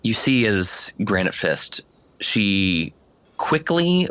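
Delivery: ordinary delivery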